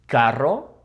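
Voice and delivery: "Carro" with a long trilled R; rolled R. Shown as a demonstration. Practice word for viewers, trilled "Carro". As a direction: roll the double R in "Carro". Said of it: "when you roll the R".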